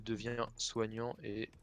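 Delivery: ordinary talk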